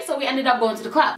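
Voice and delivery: normal talking voice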